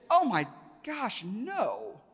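high, loud 'oh my gosh', dropping on the no; emphatic denial. Retold or imitated. Guide dismissing the idea they debate religion, emphatic denial. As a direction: act out a scandalized denial. Guide acted as shocked by question.

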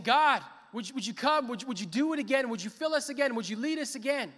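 loud, high, urgent imitated plea; crying out to God. Preacher voices the fasting people's plea to God, crying out. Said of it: "These people are crying out to God".

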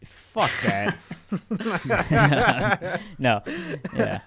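laughing. Real, not acted. Laughing after the sign-off.

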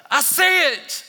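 high, loud thrilled woo; realizing the revealed word; ecstatic discovery. Retold or imitated. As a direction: erupt in delight. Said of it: "It's the".

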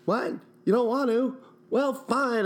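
high, whiny mock hurt; mock offended high voice.

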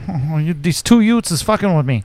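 put-on a tough-guy New York Italian accent, menacing the neighbors; Joe Pesci. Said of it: "he talks like Joe Pesci".

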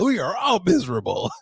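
mimicking co-host's complaint. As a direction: tease by parroting a miserable passenger. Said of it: "that's how you sound right now".

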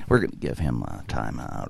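low, soft, deadpan manager; fake manager voice. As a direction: hand down the punishment low and calm, like a stiff manager. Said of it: "could actually do characters and voices".

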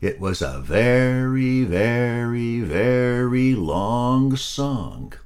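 very long song said very slowly; drawn-out emphasis. A narrator's reading. Storyteller stretches very long.

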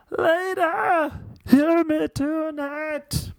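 high, strained Kermit-like singing. Pretend. Host singing in his stage voice. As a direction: sing it like a frog puppet. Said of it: "Why do I sound like Kermit".

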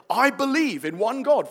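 ringing statement of faith; declaring. Shown as a demonstration. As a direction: proclaim it like the creed in church. Declaring the creed aloud.

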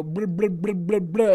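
mocking blah. Merdick mocking the customer, dismissive blah. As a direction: brush him off with silly noise. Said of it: "And Merdick go".

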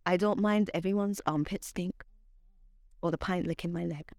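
measured spoken word delivery; poetry reading. Acted. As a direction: perform it like a slam poem, savoring lines. Spoken word poem recital.

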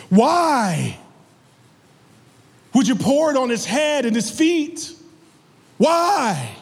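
high, drawn-out incredulous questioning; incredulous challenge. Acted. Preacher voicing the onlookers questioning Mary's perfume, incredulous challenge.

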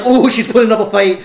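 mock excitement. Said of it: "I'm like".

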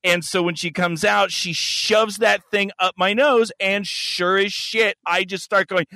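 normal talking voice

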